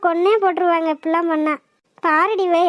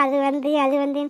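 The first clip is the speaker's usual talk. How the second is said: mocking imitation; 4 semitones lower, 4 dB quieter than usual. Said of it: "இவ என்னடி லூசா இருப்பவளா?".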